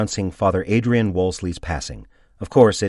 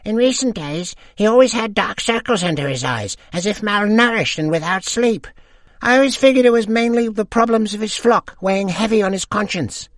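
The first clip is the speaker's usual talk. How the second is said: put-on much higher gossiping old woman voice; old lady; 14 semitones higher, 6 dB louder than usual. A narrator's reading. Second old lady gossiping with friends, high voice. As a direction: chime in like a gossipy widow.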